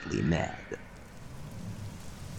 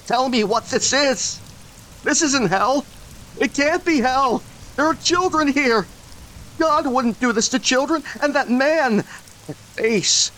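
frantic shouting. Acted. Derek shouting at Josiah that this isn't hell, frantic high yell. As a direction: pour it out, near hysterical.

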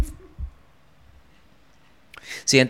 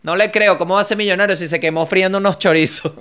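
high, loud doubting voice; skeptical viewer; 8 semitones higher, 6 dB louder than usual. Retold or imitated. Hollywood audience doubting a millionaire, loud scoff. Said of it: "Te ven a ti en una película".

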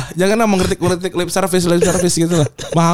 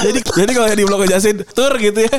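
laughing; 7 semitones higher than usual. Real, not acted. Laughing over San Marino joke.

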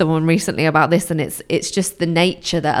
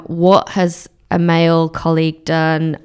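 usual speaking voice

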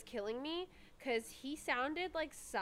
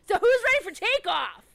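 excited; 9 semitones higher, 13 dB louder than usual. Acted. Pilot announcement skit.

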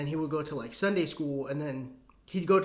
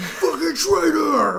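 much higher, louder, very slow yell; mock shout; 10 semitones higher, 9 dB louder, much slower than usual. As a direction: drag out the angry cry of traitor. Mock zealot yelling at traitor.

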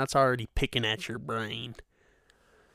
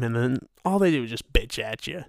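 ordinary talk, nothing marked

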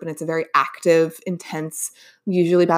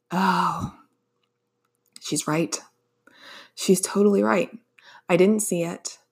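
inner voice. Retold or imitated. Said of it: "my inner voice shouting at me".

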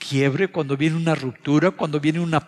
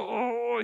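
retold pained groan; 9 semitones higher, 6 dB quieter than usual. Retelling pain after door slam.

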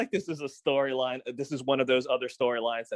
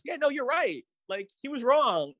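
much higher, sing-song mocking voice; mocking the show; 12 semitones higher than usual. Annoyed podcaster mocks the show's reveal, high sing-song. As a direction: parrot the show in a snide high voice. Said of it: "the show basically told us".

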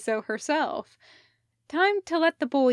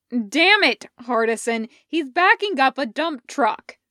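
louder, exasperated yell at a friend; angry yell; 3 semitones higher, 7 dB louder than usual. Acted. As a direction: bark it out at Hardison. Elliot yells at Hardison.

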